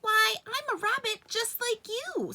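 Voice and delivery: small high bunny voice; velveteen rabbit. A narrator's reading. Velveteen Rabbit answering the wild rabbits, very high. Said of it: "said the Velveteen Rabbit".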